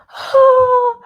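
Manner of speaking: high, breathy helpless damsel; fluttering cries for help. Pretend. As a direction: play the swooning damsel in distress.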